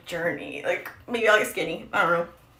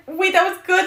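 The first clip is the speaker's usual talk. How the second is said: surprised realization; 10 semitones higher, 7 dB louder than usual. Retold or imitated. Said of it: "And I was like".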